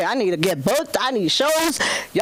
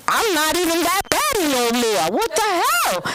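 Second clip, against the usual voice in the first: mock wail; 6 semitones higher than usual. Quoting her post-baby rant.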